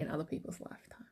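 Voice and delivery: breathy whispered aside; whispering. Acted. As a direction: drop to a whisper. Whispered aside about school.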